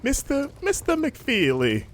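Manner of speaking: high, slow bad Irish accent; lightly put-on Irish accent. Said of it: "my Irish accent".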